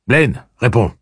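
firm demand. Acted. Eddie demanding.

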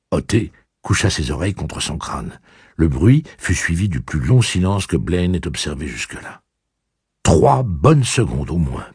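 usual speaking voice

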